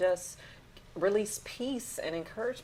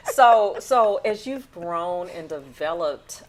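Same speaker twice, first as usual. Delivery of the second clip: ordinary talk, nothing marked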